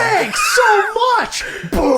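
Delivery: loud, slow, giggling blurt; laughing. Real, not acted. Friends recalling the Molotov kill, shouting through laughter. Laughing callback to funny moment.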